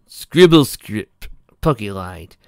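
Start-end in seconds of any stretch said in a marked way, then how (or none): 0.1-1.3 smooth fake name. Pokey lies about name.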